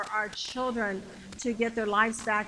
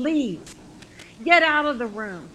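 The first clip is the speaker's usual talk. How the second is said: firm order, getting louder; stern command; 6 dB louder than usual. Retold or imitated. Jesus to the mockers in the room, sternly commanding. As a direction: order them out with authority.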